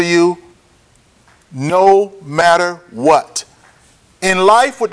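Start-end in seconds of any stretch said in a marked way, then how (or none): 1.5-3.4 NO, MATTER, WHAT each drawn out; very slow "no matter what", pausing between words; slow, emphatic repeat. Real, not acted. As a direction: stretch "no matter what" word by word. Repeats point, word by word.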